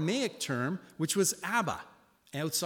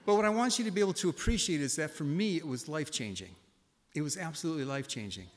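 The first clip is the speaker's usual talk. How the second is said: usual speaking voice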